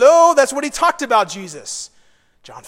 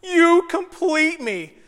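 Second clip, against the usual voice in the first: high, drawn-out mock swooning; stretched, sing-song rom-com parody; mock-romantic; 6 semitones higher, slower than usual. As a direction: ham up the cheesy love line, slowly. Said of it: "The lamest romantic comedy line ever".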